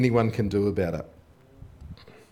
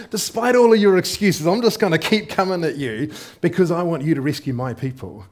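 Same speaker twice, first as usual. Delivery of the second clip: badgering; 5 semitones higher, 5 dB louder than usual. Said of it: "He is badgering Moses".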